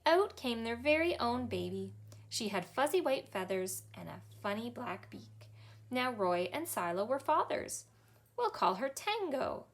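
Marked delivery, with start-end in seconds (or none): none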